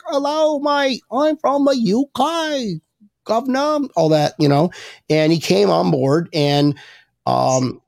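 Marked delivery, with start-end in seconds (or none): 0.1-3.8 hammed-up British accent, pitched high; mock British accent. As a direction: do a cheesy Brit saying hello mate, high. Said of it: "that's my fucking accent".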